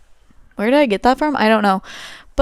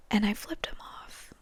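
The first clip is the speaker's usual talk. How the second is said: unvoiced confessional whisper; 14 dB quieter than usual. Real, not acted. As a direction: confess it under your breath. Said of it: "confessing a rude act".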